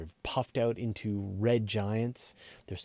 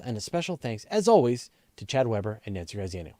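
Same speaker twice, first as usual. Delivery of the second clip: bright, louder ad-read announcer voice; bumper read; 2 semitones higher, 4 dB louder than usual. Acted. Host thanking patrons and plugging the newsletter, upbeat promo read. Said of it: "so I'm gonna do the bumper".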